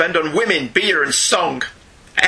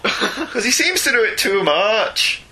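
hammed-up Scottish brogue; funny Scots accent; 5 semitones higher than usual. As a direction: put on a comic, lilting Scots voice. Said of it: "in a funny Scots accent".

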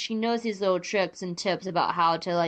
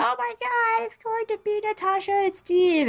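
squealing high-pitched gush; excited fangirl; 13 semitones higher, 4 dB louder than usual. Retold or imitated. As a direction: gush like a giddy fan. Mocking excited fans.

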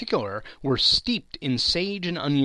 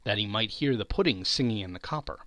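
ordinary delivery

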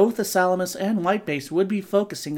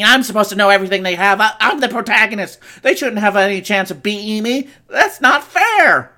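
higher and louder, rising to a wail; whiny panicking teen; 4 semitones higher, 8 dB louder than usual. Acted. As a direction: whine it out like a spoiled kid. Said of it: "he's all".

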